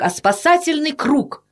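ordinary delivery, nothing marked

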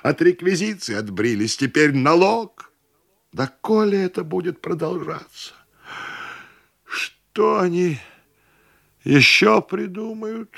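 high-pitched aggrieved lament; sorrowful wailing. A narrator's reading. Host bewails endless levies, woefully. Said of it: "горестно завопил хозяин".

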